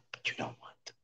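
whispered rebuke. Retold or imitated. Baal Shem Tov repeats the rebuke.

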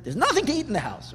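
put-on high, fast childish whine; whining kid. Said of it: "You ever hear your kids tell you that?".

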